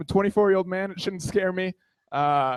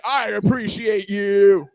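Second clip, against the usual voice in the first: slow, louder hammed-up seduction; mock dirty talk; 2 semitones higher, 5 dB louder, slower than usual. Shown as a demonstration. Showing what dirty talk isn't, mock sexy. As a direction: stretch it out as if sexy. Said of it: "not familiar with dirty talk".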